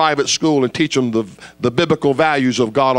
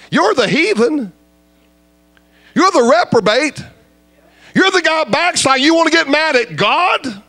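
retold rebuke; 11 semitones higher than usual. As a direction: lay into him like a pulpit rebuke.

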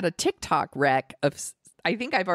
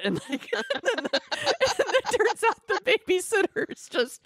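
high, breaking-up giggly speech; laughing while talking. Real, not acted. Telling the babysitter punchline, overcome with laughing. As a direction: struggle to speak through laughter. Cracking up at the cat story.

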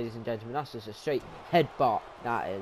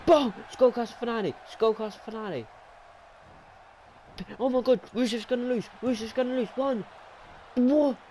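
very high, near-shrieking call; stunned excitement; 10 semitones higher than usual. Real, not acted. Commentator calling a shock finisher, very high and stunned. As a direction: disbelief at the upset. Finisher lands, expects upset.

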